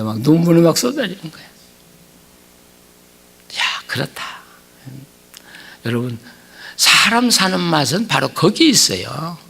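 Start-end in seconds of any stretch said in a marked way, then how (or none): none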